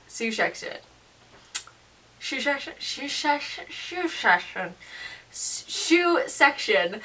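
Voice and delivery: quiet goofy wordplay on shoe section; playful repeating. Pretend. Playing with the words shoe section.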